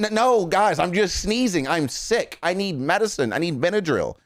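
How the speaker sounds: high-pitched pleading protest, played up; hammed-up whiny insistence; mock exasperation. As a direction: plead with overreading fans in pretend despair. Acts protesting star against fan theories.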